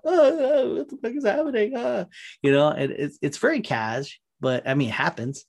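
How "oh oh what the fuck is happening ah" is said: much higher, louder mock panic; hammed-up panicking counselor; Deanna Troi panicking. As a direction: cry out as if melting down. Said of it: "She's like".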